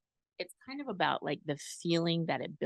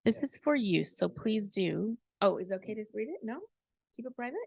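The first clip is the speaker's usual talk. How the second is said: ordinary talk, nothing marked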